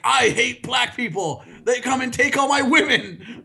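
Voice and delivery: high mock voice of the ranting cab driver, laughing at the end. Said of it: "racist driver that's like".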